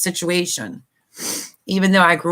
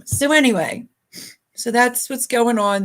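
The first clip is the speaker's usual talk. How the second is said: choked up; 5 semitones higher than usual. Real, not acted. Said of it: "cry or get choked up".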